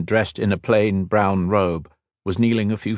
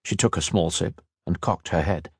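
ordinary delivery

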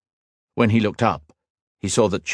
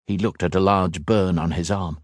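plain reading voice

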